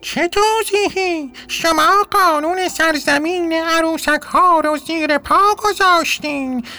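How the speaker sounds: a tiny king scolding the dolls for breaking the law; hammed-up cartoon royal outrage; angry squeaky king. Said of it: "با عصبانیت گفت".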